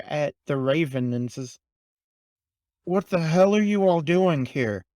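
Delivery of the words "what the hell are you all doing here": loud, higher angry shout; angry demand. Acted. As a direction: bark the question at them. Crow confronts them angrily.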